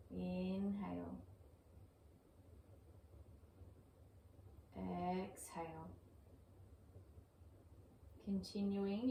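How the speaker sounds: calm, even yoga breath cues with long pauses; soothing, unhurried meditation-teacher voice; guided breathing meditation. Acted. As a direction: guide the breath gently, leaving space between phrases.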